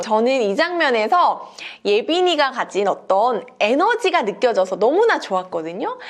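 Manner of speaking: usual speaking voice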